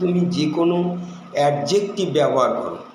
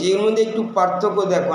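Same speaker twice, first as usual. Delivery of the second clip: ordinary talk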